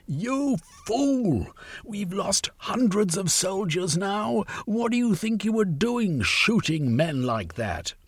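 high, slow rebuke; angry shout. A narrator's reading. Velveen rounds on Sylvia, angry and high. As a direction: round on her in anger. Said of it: "Velveen rounded on Sylvia and shouted".

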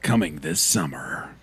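low, slow trailer announcer; movie trailer voice. Pretend. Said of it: "read it like a trailer?".

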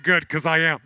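loud, high, frazzled retelling; hammed-up frantic rush; scrambling. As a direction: blurt it out loud and harried. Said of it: "I'm, like, scrambling".

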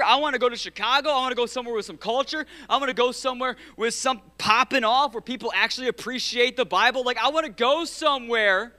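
restless complaint. Retold or imitated. Young pastor complaining to God about his city, whiny. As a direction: gripe like you're itching to leave town. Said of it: "And I'm going".

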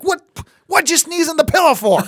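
mock-furious at a kid who sneezed; exasperated outburst. Parent scolding a kid for sneezing in pillow, high loud exasperation. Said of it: "I'm so angry".

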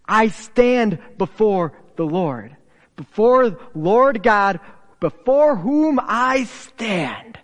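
loud, slow, emphatic proclamation; proud boast. Retold or imitated. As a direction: boast it boldly, word by word. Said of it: "who proudly boasts".

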